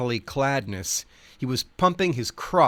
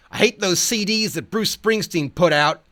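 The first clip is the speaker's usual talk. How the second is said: higher and louder, a hammed-up howl; 8 semitones higher, 6 dB louder than usual. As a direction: howl it like the wild cowboy. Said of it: "The Ledge howls".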